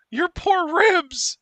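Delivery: mock sympathy. Real, not acted. Reacting to a move on the ribs.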